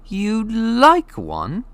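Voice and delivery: high and drawn-out disbelief; can't believe anyone would; incredulous Harry. A narrator's reading. Harry amazed that Hagrid likes dragons.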